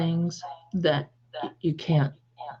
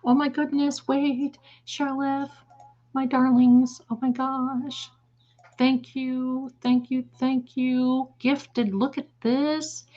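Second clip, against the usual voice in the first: much higher, gushing thanks; delighted gushing; 9 semitones higher, slower than usual. Real, not acted. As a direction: squeal with delight at the gift. Gushing over a viewer's gift.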